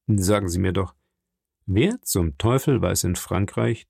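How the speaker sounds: low, measured skepticism; calm, reasoning Count. Acted. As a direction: reason coolly with a heated friend.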